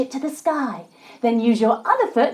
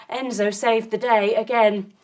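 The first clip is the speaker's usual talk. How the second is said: usual speaking voice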